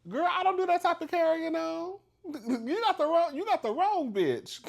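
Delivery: high, loud mock-indignant outburst; hammed-up loud indignation; outraged refusal. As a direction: shriek the refusal like you are appalled. Acted outraged refusal of arson offer.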